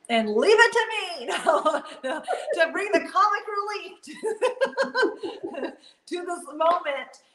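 giggling through the words, stretched slow; real amused laughter breaking the line; laughing. Real, not acted. Laughing at her own mishap.

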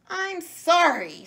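sneezed apology. A narrator's reading. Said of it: "sneezed the monkey".

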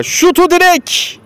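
high, drawn-out shout at the post; slow, rising cry as it hits the post; excited commentator. Real, not acted.

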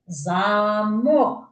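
"замок" with long, rising мок; stress on second syllable. Shown as a demonstration. Teacher repeats the lock word, мок in "замок" stressed. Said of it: "наголос на другий склад".

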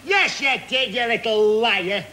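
normal talking voice

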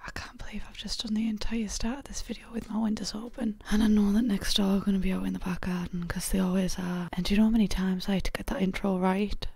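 real hushed whisper. Real, not acted. Vlogger confiding about neighbours next door, whispering. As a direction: whisper it, wary of being overheard. Windows open, neighbours may hear.

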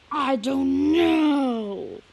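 slow, dragged-out I don't know; very slow, loud sulky answer; slow drawn reply. Acted. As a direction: stretch it out slowly.

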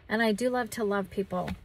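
ordinary delivery

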